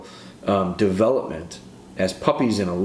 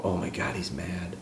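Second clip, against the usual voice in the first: scared dog's thought; 3 semitones lower, 10 dB quieter than usual. Pretend. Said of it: "they'll go".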